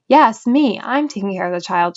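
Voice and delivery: usual speaking voice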